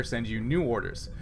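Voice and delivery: ordinary delivery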